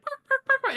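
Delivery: high yappy mock barks, each one popped; imitating dogs barking. Telling the webinar story, mimicking her small dogs yapping, high mock barks. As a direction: yap like a little dog. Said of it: "my little dogs are like".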